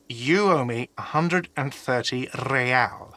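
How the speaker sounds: slow, measured repeat of a demand; pointedly slow. Acted. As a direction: spell the debt out word by word. Repeats the demand, spelled out slowly.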